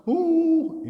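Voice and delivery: high, loud mock sob "uh"; imitated crying wail. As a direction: wail loudly as if bursting into tears. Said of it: "pas lagi nanya nangis". (tiruan tangis melengking)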